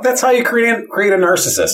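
high-pitched, loud mock protest; hammed-up high objection; mock outrage. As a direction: squawk it far above your own voice in jest.

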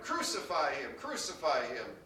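ordinary delivery

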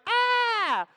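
high, excited "ah" yell; happy shout. Acted. Reacting to a caller who makes him happy, high happy shout. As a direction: let out a high shriek of delight. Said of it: "you make me happy today".

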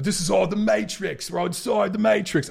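put-on Matrix line in a half-Australian, half-British accent; lightly put-on British accent, slipping into Australian; mock British accent. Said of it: "making the Matrix British ... kind of Australian".

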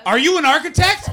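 loud, high incredulous question; mock outrage. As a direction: demand it at full volume in mock disbelief. Mock interrogation of audience member.

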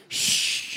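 sharp unvoiced shush; imitated library shushing. Library-goers hushing a noisy person, imitated shush. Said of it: "you hear people just going".

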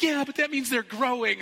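high, loud eager insistence; bubbling over with joy; excited. Retold or imitated. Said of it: "And she's like".

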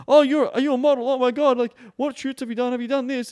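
high, loud mock starstruck squeal; gushing fanboy. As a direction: gush like an over-eager fan meeting a model. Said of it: "like a fucking little fanboy".

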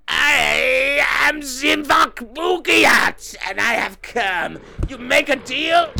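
slow, high, wailing demon shout; drawn-out theatrical Satan; devil. Pretend. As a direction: proclaim it as a hammed-up devil. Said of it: "You're the devil".

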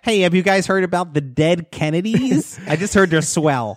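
put-on high-pitched, naive younger kid; eager kid. Younger kid talking to the cool skaters, eager and high. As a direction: chirp it like a kid desperate to fit in. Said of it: "I would be like the kid".

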